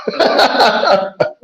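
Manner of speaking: loud high burst of laughter; big laugh. Real, not acted. Laughs at own nervous memory.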